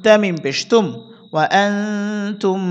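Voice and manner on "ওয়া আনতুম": recitation. Shown as a demonstration. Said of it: "reads combined word".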